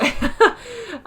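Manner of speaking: bright laugh at yourself; amused laugh. Real, not acted. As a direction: laugh off the codex obsession. Laughs at own codex habit.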